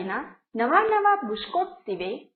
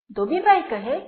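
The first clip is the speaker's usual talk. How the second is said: ordinary delivery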